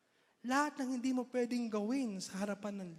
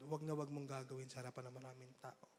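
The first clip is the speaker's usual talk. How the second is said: usual speaking voice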